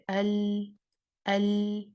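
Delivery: ال said slowly with sound off the tongue sides; demo of lam. Shown as a demonstration. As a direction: show the ال sound leaving from the sides. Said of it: "اس کی آواز آپ دیکھیں گے side سے نکل رہی ہے". (ال کو سائیڈ سے ادا کرنا)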